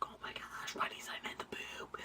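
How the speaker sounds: urgent whispered confession. Retold or imitated. Telling her friend about the signed shirt, whispering. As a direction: hiss it low to a friend. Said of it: "it first started off as a whisper".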